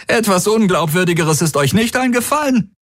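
hoher, spöttischer Unglaube; höhnisch auflachend. A narrator's reading. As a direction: ihm die Ausrede lauthals verspotten. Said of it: "Tari lachte ihm ins Gesicht". (höhnisch, ungläubig)

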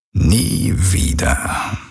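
sehr langsam geflüstert, drohend; raunend. A narrator's reading. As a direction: die Worte gedehnt und tonlos hauchen. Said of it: "raunte er". (der Vater droht der Tochter im Flüsterton, sehr langsam)

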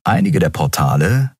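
plain reading voice

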